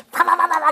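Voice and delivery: rapid high-pitched "pa-pa-pa" sound effect; imitated patting noise. Host imitates the masseuse patting her client, rapid high patter. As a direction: mimic brisk pats with the voice.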